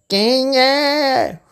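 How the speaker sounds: wolf pretending to be the old grandma; wolf faking grandma. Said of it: "imitando a voz da vovozinha". (lobo imitando a voz da vovó)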